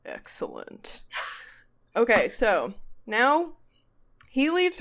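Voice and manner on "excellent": low, soft mock-sinister murmur; hushed, gloating impression; mock villain. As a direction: imitate a scheming villain. Imitating host's sinister approval.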